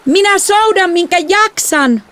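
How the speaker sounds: very high, strained yell; slow, high-pitched holler; yelling back. A narrator's reading. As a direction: shriek back in protest while rowing. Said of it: "minä kiljuin takaisin".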